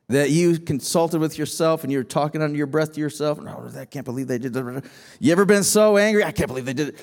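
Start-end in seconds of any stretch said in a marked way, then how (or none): 3.4-4.8 low angry muttering to oneself; muttering under breath. Pretend. Muttering to himself in anger. 6.2-7.0 low angry muttering to oneself; muttering under breath. Pretend. Muttering to himself in anger.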